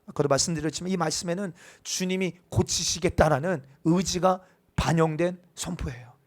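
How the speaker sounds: usual speaking voice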